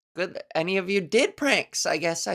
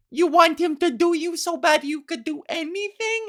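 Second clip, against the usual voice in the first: put-on high childish voice; imitating the kid's final line; kid's punchline; 8 semitones higher than usual. Quoting the kid's gross ending, high. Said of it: "he finishes on".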